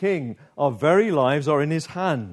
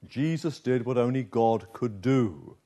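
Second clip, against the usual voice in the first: ordinary talk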